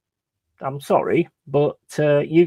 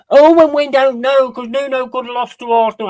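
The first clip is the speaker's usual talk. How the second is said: high, whiny mock voice, faster toward the end; mocking fan voice; 11 semitones higher, 7 dB louder than usual. Mocking a Forest fan's Twitter excuse in a high sneering voice. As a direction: parody a clueless fan's excuse. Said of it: "that's like a proper Top Gooner comment".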